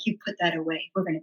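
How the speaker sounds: low, soft mock-stern order; playful order. Telling her mom to put the map away, soft mock-stern. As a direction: scold her gently but mean it.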